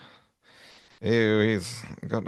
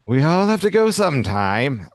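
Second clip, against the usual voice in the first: philosophical villain; 5 semitones higher, 5 dB louder than usual. Retold or imitated. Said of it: "he's a philosopher".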